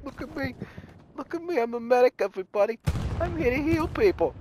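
high, preening mock voice mimicking the medic. Mocking a teammate who brags that he is a medic, in a high, preening voice. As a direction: sneer it like a show-off medic. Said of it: "He says".

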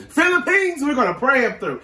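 high, loud rallying call for the Philippines; excited cheer. Real, not acted. Co-host rallies Filipino fans behind the singer, excited and high. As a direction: lift it up like a cheer for your people.

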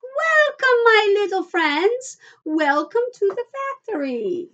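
ringing high-pitched showman's greeting; called out. A narrator's reading. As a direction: call out grandly in a high, fluty voice. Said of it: "he called out".

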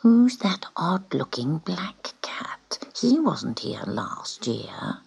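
slow, soft gossiping whisper. Acted. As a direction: gossip behind the newcomer's back. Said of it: "The other cats began to whisper".

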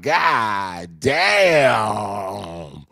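loud, odd-voiced "God damn" shouted out.

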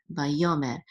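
usual speaking voice

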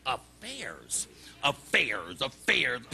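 low recited heading; reading from notebook. Acted. Forgetful man checks his book for affairs, low reciting. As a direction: search down the list in a low voice.

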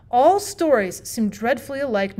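ordinary delivery, nothing marked

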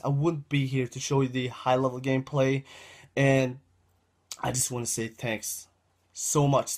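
ordinary talk, nothing marked

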